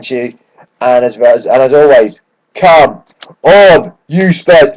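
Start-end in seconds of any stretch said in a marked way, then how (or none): 2.5-4.7 rallying call. Real, not acted. Sign-off chant.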